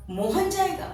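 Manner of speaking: मोहन जाएगा with जाएगा lifted high in surprise; surprised. Shown as a demonstration. Teacher demonstrating a surprised tone to students. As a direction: sound amazed that Mohan will go. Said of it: "आश्चर्य को प्रकट करता है".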